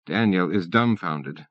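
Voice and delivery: plain reading voice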